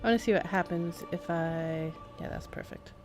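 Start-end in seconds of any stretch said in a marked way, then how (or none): none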